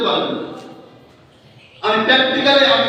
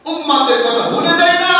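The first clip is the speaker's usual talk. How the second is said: raised, ringing chant ending on a held "না"; rousing preacher's call; 4 semitones higher than usual. Shown as a demonstration. Preacher repeats the point to the congregation, rousing call. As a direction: call it out to the congregation, last word stretched.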